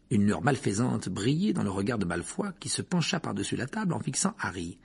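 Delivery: plain reading voice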